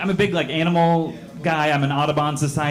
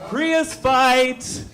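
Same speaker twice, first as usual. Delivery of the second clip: much higher hallway call, mock excited; yelling; 12 semitones higher than usual. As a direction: call it out down the hall, pitched way up. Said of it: "leans his head out in the hallway and yells".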